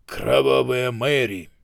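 slow, loud, commanding order; war-declaring voice. A narrator's reading. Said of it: "словно войну объявлял".

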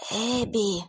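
weak, hoarse, barely audible call; hoarse faint call. Acted. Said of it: "хрипло и еле слышно позвал Уилл".

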